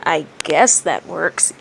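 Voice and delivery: slow, grudging concession; reluctant. A narrator's reading. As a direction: give in slowly, unwillingly. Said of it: "Ibiki conceded reluctantly".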